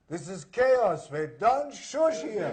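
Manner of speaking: put-on high, loud German-accented scolding; hammed-up indignant German accent; German villain accent. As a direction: bark it slowly as an outraged German villain. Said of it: "Can you do a German accent".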